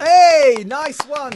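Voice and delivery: loud, high cheer; excited cheer. Real, not acted. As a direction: whoop it out. Cheering the directory news.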